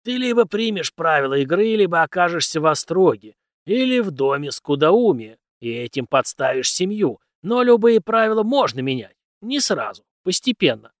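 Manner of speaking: loud, emphatic mentor lecturing a naive pupil; forceful, declaiming teacher figure; cynical old teacher. A narrator's reading. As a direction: hold forth loudly, with scornful rhetorical questions. Teacher voiced in narrated story.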